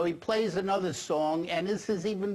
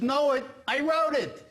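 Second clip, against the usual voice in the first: punchline; 8 semitones higher, 4 dB louder than usual. Acted. Punchline delivered louder.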